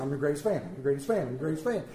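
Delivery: low, rapid muttered repeats; nervous rehearsing. Acted. Admirer rehearsing before meeting his hero, hurried low mutter. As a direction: rehearse it under your breath. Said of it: "I'd be rehearsing".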